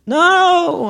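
put-on a scared kid flatly refusing; high yelped refusal; frightened little boy.

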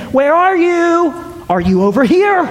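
high, drawn-out "where are you" of hide-and-seek; mock searching, stretched and lilting; playful seeker calling. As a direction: call out teasingly, as if searching for a hiding child. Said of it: "and he's saying".

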